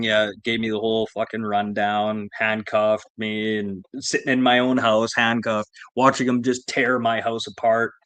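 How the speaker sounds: ordinary delivery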